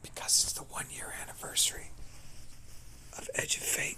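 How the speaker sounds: whispered like a secret; hushed conspiratorial whisper; secretive whisper. Pretend. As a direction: lean in and breathe it like a secret. Secretive reveal of the anniversary.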